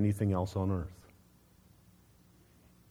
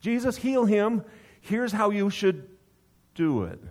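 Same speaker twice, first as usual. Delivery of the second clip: higher, louder bossy demand, slowed; dictating demand; 8 semitones higher, 4 dB louder than usual. Retold or imitated. Father dictating to Jesus how to heal, loud and bossy. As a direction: tell Jesus exactly what to do. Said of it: "he wants to dictate how and when".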